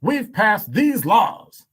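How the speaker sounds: high, slow, loud politician declaiming; fake politician voice. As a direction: proclaim it like a self-congratulating politician. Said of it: "that's my fake politician voice".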